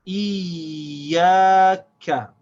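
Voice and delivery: IYAKA with a long, loud held y, then short ka; emphasized shad. Shown as a demonstration. Teacher sounding out three syllables, drawn out and joined. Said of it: "can't stop the sound of the ya".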